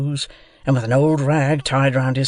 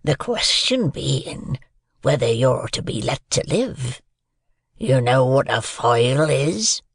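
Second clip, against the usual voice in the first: slow, drawn-out rough voice; menacing convict; slower than usual. A narrator's reading. Convict decides whether the boy lives, menacing. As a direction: question slowly, with a threat underneath.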